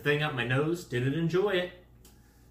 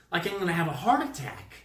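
ordinary delivery

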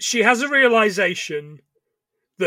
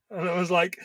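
ordinary talk, nothing marked

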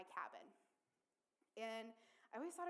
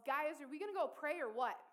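louder, higher imitated call to a group; retold yell; 5 semitones higher, 8 dB louder than usual. Boy yells back to the kids at the campfire, imitated yell. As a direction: call it out like a kid rallying the others. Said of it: "and he yells".

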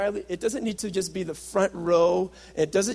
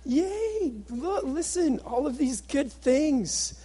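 mock-excited, much higher, squealing yay; wife's excited phone call; 9 semitones higher, much slower than usual. Wife calling husband with good news, much higher and excited. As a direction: gush like an overjoyed wife bursting with news. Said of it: "so excited".

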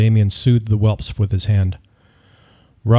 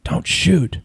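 high, strained whispered cry; whispery yell; 7 semitones higher, 3 dB quieter than usual. A narrator's reading. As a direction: yell it on a breath with no voice. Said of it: "yelled with a whispery voice".

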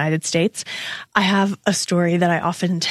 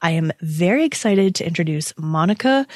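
ordinary delivery

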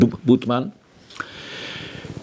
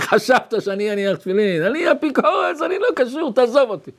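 high-pitched mock outburst, far above normal voice; scornful retort; 8 semitones higher than usual. Reform rabbi scorning the offer of tefillin, high mocking protest. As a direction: imitate a scoffing, agitated refusal. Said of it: "הוא צוחק".

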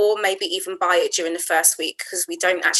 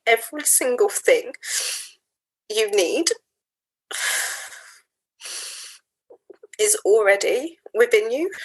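solemn affirmation. Real, not acted. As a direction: speak each line as a sacred promise. Slow solemn affirmations, long pauses.

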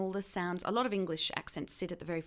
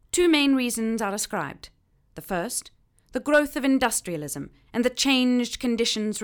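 loud, declaiming heightened received pronunciation with Australian vowels; heightened RP with a rolling tapped R; 1940s heightened RP; 4 semitones higher, 10 dB louder than usual. Acted. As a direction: read it like a 1943 broadcast in heightened RP. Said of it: "which is called heightened received pronunciation".

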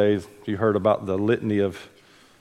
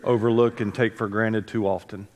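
prayer; 3 semitones lower than usual. Real, not acted. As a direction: pray gently over the congregation. Opening prayer before the sermon.

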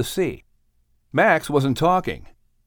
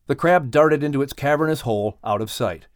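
ordinary delivery

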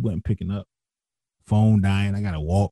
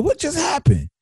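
very high-pitched mock shock; retold astonishment. Homeboy finds the car torn apart, imitated astonishment. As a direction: act out a friend's disbelief, voice leaping up. Friend's shocked reaction, re-enacted.

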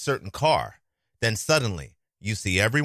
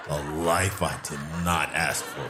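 ordinary talk, nothing marked

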